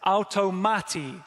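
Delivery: automati said slowly, AL-to-MA-ti; Greek pronunciation. Shown as a demonstration. Preacher teaching the Greek word automati, slow and clear. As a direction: sound out automati carefully for the congregation. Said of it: "that first word is pronounced like this".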